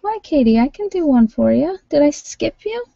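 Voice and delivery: lifted, high and eager; bright high talking voice. Real, not acted. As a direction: pitch up as she reaches out to a viewer.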